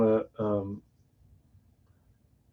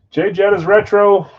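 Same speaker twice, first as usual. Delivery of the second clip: excited announce; 8 semitones higher, 8 dB louder than usual. Real, not acted. Announcing pulled card.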